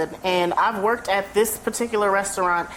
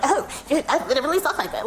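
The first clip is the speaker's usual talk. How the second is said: higher, mocking imitation with amusement; mimicked retort; 5 semitones higher than usual. Imitating somebody at the restaurant, mocking. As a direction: mimic someone while half laughing. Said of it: "somebody be like".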